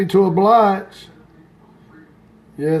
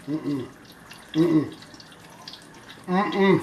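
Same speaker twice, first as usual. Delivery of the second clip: soft lower hums of delight; savoring hums; 4 semitones lower, 9 dB quieter, much slower than usual. Real, not acted. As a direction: hum like the food is heavenly. Tasting a hot egg roll.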